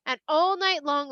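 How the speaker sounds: plain reading voice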